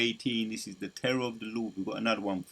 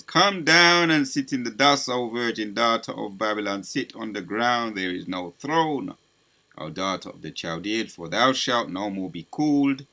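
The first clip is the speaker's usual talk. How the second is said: forceful scripture declamation; 6 dB louder than usual. Real, not acted.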